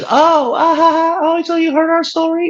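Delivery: high, gushing put-on lady voice; mock lady. Mocking the ice cream lady, high and gushing. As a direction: squeal it like the oblivious lady. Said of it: "the lady goes".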